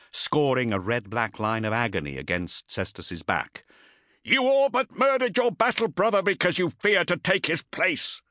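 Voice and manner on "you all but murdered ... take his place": much higher, louder drill sergeant shout; shouting taskmaster. Acted. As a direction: bellow the charge at the coward. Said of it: "the taskmaster shouted".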